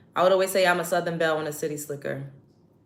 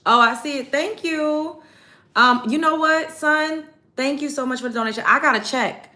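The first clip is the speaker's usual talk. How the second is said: excited thanks; 8 semitones higher, 7 dB louder than usual. Real, not acted. Thanking a viewer for donation.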